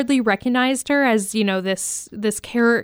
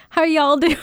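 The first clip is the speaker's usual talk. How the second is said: higher, hammed-up Southern radio hello; mock Southern greeting; 6 semitones higher, 4 dB louder than usual. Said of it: "I'm like".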